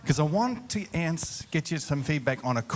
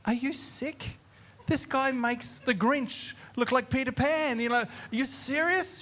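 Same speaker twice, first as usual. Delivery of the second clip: high, disbelieving protest; can't believe she means it; incredulous; 9 semitones higher than usual. Retold or imitated. Pastor retelling his reaction to his wife's idea, high disbelief. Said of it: "I looked at her and I said".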